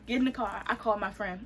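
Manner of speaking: ordinary delivery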